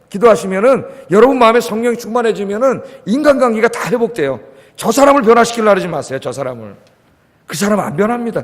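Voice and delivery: normal talking voice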